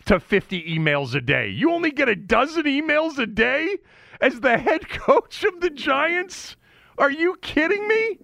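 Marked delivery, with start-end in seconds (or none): 1.5-8.2 pitched way up, scoffing, are you kidding; incredulous. Real, not acted. Host scoffing at the coach's dozen emails, high incredulous voice. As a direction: voice shooting up high in disbelief.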